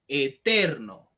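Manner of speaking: "eterno" broken after E, with TER leaned on; teacher's demo of "eterno", marked stress on TER; stress on middle syllable. Shown as a demonstration. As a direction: load the voice onto TER in "eterno". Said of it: "cargar más nuestra voz".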